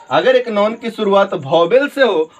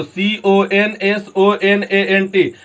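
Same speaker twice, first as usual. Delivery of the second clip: slow spelling; much slower than usual. Shown as a demonstration. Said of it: "teacher spells consonant".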